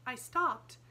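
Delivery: a hint of a polite little girl; lightly voiced young Sarah; light child's voice. A narrator's reading.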